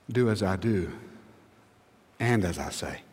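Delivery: low and soft, with a pause midway; gently weighty, unforced; quiet, measured quote. Retold or imitated. Paul's words set against granddaddy's.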